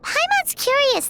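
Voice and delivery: curious. Acted. Paimon perks up.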